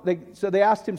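ordinary talk, nothing marked

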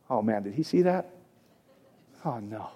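hushed, sinking "oh no"; under the breath, as if caught out; acted quiet dread. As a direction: drop to a soft, low mock worry. Voicing dread of being seen.

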